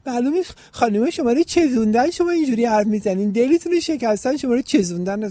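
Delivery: high, loud, singsong mimicry of hecklers; put-on high voice, hammed-up and loud; mocking critics. Mimics critics accusing him of hurt.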